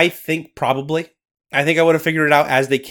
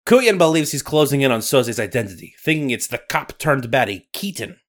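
put-on Scottish accent. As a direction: narrate like a Highland storyteller. Nessie narration.

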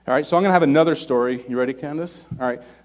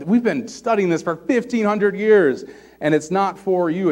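high, drawn-out mock outrage; slow, raised-pitch imitation of indignation; indignant protest; 5 semitones higher than usual. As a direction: act out an affronted expert protesting. Imagined Pharisees protesting, voice raised.

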